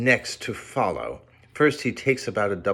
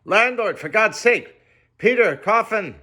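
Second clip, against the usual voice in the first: high, loud cry for help; panicked shout; 12 semitones higher, 5 dB louder than usual. A narrator's reading. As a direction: yell for the landlord in terror. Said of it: "shouted I".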